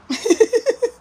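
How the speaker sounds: short high laugh. Real, not acted. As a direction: break into a laugh.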